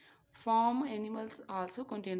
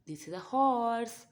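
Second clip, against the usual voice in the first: big reveal; 4 semitones higher, 9 dB louder than usual. Pretend.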